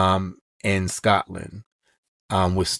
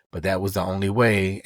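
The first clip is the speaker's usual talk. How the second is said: ordinary delivery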